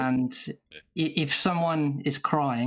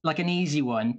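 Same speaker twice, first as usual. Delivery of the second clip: ordinary talk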